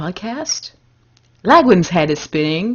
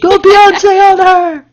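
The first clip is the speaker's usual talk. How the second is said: loud high cheer, slow; 10 semitones higher, 7 dB louder, slower than usual. Real, not acted. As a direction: cheer her on.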